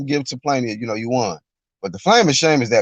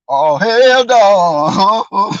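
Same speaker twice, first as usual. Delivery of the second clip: even slower, loud, stretched hell no; drawn-out hell no; 7 semitones higher, 5 dB louder, much slower than usual. Retold or imitated. A viewer reacting again, dragging it out even more. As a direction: drag every word in disbelief.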